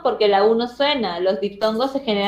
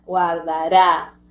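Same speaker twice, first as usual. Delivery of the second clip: "Guardará" drawn out slowly in syllables; 4 semitones lower, much slower than usual. Shown as a demonstration. Teacher showing pupils how it splits, "Guardará" syllable by syllable. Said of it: "guard debía estar en la misma sílaba".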